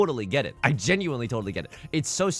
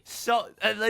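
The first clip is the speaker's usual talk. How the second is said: ordinary talk, nothing marked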